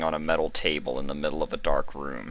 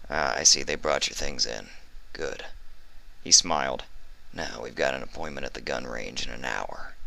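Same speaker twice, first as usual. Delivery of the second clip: a much softer, low casual voice; Skolder voice; 4 semitones lower, 5 dB quieter than usual. A narrator's reading. Skolder entering Vinyl's room, casual. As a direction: greet her offhand.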